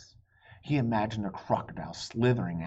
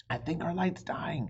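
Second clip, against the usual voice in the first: put-on worried kid; 8 semitones higher than usual. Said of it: "he said to Annie".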